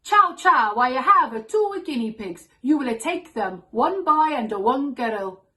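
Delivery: loud, hammed-up Italian accent; thick Italian accent. Father phoning his daughters about guinea pigs, thick Italian accent. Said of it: "said in his thick Italian accent".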